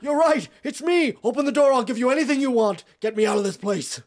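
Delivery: high, loud plea through a door; frantic captive calling for rescue; desperate shouting. Acted. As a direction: beg loudly to be let out.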